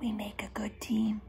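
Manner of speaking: soft whispered aside; whispered, no voice at all; quietly. Acted. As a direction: whisper it close and shy. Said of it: "said to her quietly".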